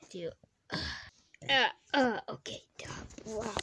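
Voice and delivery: usual speaking voice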